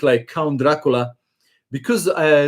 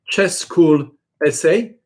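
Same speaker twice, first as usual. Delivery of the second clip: spelling it out; slower than usual. Shown as a demonstration. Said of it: "I'm going to type the website again".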